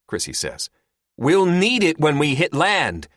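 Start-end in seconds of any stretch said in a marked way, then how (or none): 1.2-3.1 high, loud, drawn-out protest; exclaiming. A narrator's reading. Terry to Chrissy about the cart, exclaiming. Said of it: "Terry exclaims".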